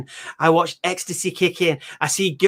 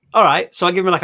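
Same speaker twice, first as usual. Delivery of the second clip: ordinary talk, nothing marked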